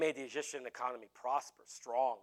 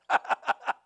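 loud voiceless laugh; laughter; 6 dB louder than usual. Real, not acted. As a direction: burst out laughing at your own act. Laughs at own tyrant act.

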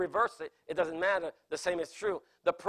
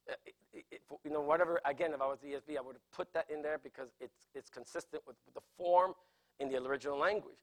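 ordinary delivery

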